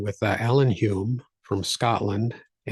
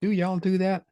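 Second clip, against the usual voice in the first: put-on Southern accent; 6 semitones higher, 3 dB louder than usual. Co-host teasing about picking up accents, Southern accent. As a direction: play up a mock Southern accent. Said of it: "done that a little with the Southern accents too".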